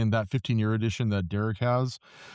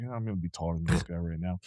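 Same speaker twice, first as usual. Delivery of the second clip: lower, softer macho self-talk; puffed-up chest; 8 semitones lower, 7 dB quieter than usual. Retold or imitated. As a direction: mutter it like you're sizing him up. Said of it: "puffed up my chest a little bit".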